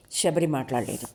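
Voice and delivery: usual speaking voice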